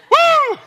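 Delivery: high, loud joyful woo; excited whoop. Real, not acted. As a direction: let out a thrilled holler. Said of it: "Got a little excited there".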